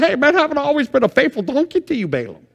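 high, fast put-on donkey voice; talking donkey. Preacher voicing the donkey talking back to Balaam, high and quick. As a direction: play the donkey pleading with its rider. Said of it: "Donkey turns around and says".

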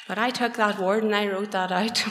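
ordinary talk, nothing marked

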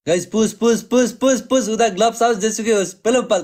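rally the viewers; hyped urging. Real, not acted. Streamer urging viewers to push the score, hyped.

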